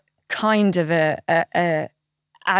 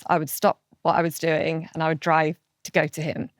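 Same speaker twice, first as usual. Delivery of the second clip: ordinary talk, nothing marked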